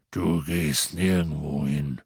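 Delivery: low, slow, gruff giant's growl; big gruff friend refusing to let him go. Acted. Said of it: "knurrte Hagrid".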